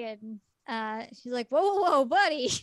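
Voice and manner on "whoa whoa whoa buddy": startled soothing. Retold or imitated. She finds him sobbing.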